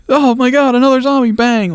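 high-pitched pretend alarm; mock panic. Human running into a zombie, mock panic. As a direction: play a startled survivor, voice jumping high.

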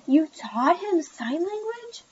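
high, slow, incredulous question; hurt surprise, drawn out and high; taken aback. A narrator's reading. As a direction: stretch the question out, rising in disbelief. Allie finds her teacher taught him.

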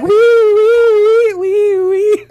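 high, loud police siren wee; siren imitation. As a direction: wail like a cop car. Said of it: "Like a cop car".

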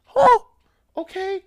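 squeaked huh, then a small resigned okay; startled kid's squeak turning meek; high shocked huh. Retold or imitated. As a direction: go way up in disbelief, then give in. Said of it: "He's like".

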